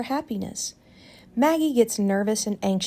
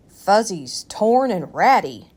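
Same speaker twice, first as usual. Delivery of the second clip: father's voice; 5 dB louder than usual. A narrator's reading.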